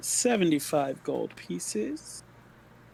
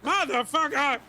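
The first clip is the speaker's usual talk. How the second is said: frustrated shout; 9 semitones higher, 5 dB louder than usual. Real, not acted. Frustrated outburst.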